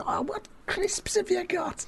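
very high Scouse question; Scouse accent. Acted. [name] asking the barman about snacks, high Scouse accent. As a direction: squeak it out in comic Scouse. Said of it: "and I said".

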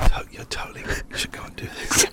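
breathy ASMR whisper into the mic. Acted. Radio hosts trying a whispered ASMR bit. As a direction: whisper it close, ASMR style.

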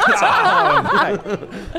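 high, slow, laughing repeat. Real, not acted. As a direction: crack up while saying it. Laughing mockery.

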